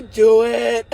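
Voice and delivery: shouted dare, loud and defiant. Real, not acted. Daring her would-be killers to go ahead, shouted. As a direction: yell it back at them, daring them. Defiant reply to death threats.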